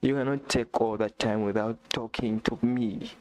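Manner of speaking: ordinary talk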